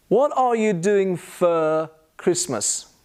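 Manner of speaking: "for" said as "fuh", no r; slow, careful model with "for" as "fuh"; spaced words, weak "for"; schwa in FOR. Shown as a demonstration. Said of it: "move on to the word for".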